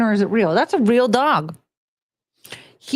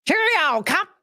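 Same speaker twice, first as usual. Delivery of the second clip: British accent attempt; 7 semitones higher than usual. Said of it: "speak in that kind of accent".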